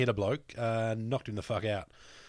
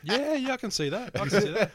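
laughing agreement; 10 semitones higher, 5 dB louder than usual. Real, not acted.

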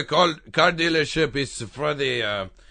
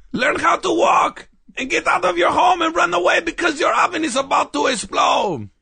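panicked shout; 11 semitones higher, 3 dB louder than usual. Acted. Shouting warning at baby.

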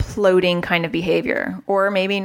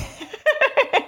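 high burst of laughter at her own slip; amused laugh at herself. Real, not acted. Laughing at herself after interrupting her own sentence. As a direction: laugh at catching yourself mid-sentence. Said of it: "I'm interrupting myself".

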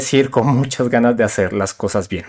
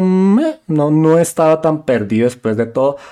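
higher-pitched doubtful "meh"; dismissive second thought; 4 semitones higher than usual. Retold or imitated. Speaker correcting his imagined past self, dismissive second thought. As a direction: wave off your own claim, pitched up.

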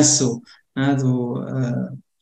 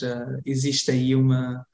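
ordinary delivery

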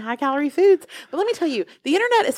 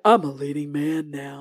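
lower, deep leading-man voice; Austin Butler; 8 semitones lower than usual. Retold or imitated. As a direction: drop low like a movie star. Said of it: "took on this other voice that was like".

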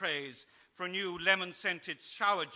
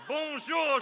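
mock French; 8 semitones higher, 5 dB louder than usual. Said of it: "she added".

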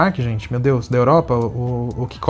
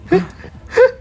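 high squealing laugh. Real, not acted. Laughing at the silly talk.

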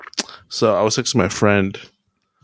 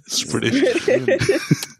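laughing word. Shown as a demonstration. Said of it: "Oh, Spanish British".

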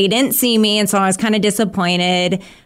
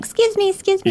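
overly polite excuse me; 13 semitones higher, faster than usual. Retold or imitated. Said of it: "felt really mean being like".

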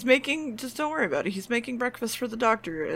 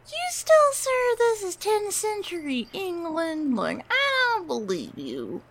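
high, slow whiny kid impression; five-year-old; 10 semitones higher, slower than usual. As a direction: protest like a small child, starting very high and sliding down. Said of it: "turned into a fucking five-year-old".

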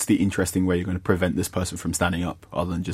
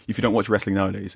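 ordinary talk

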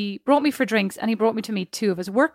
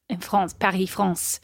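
put-on playful French-accented place names; 3 semitones lower, slower than usual. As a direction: slip into a mock French accent. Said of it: "I just have to do that accent".

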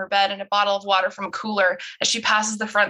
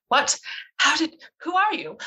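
higher, stammered shock; startled disbelief; 7 semitones higher than usual. Acted. Bess to a stranger speaking her family's language, startled. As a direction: blurt it out, thrown.

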